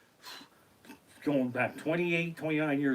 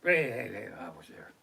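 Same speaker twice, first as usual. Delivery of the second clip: guilty admission; 6 semitones lower than usual. Acted. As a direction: trail down into a near-whisper. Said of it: "it's like an admission of guilt".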